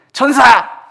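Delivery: high, loud call to the angels; mock shouted command. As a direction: bark it like a furious order. Imagined angry command.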